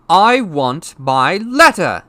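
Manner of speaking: slow, high shouted demand. A narrator's reading. Boy refusing to move, slow shouted demand. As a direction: shout it out word by word. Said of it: "he shouted".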